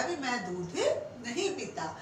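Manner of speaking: ordinary talk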